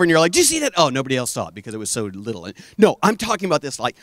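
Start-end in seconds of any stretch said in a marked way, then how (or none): 0.3-0.7 high-pitched mock amazement; excited stargazer. Imitating someone spotting a tiny shooting star, excitedly. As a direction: pipe up high, as if spotting something.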